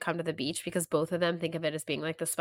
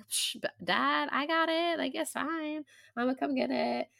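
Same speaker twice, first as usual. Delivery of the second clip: imitated dismissive daughter; 7 semitones higher than usual. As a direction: voice a girl playing it down. Voicing daughter brushing off Dad.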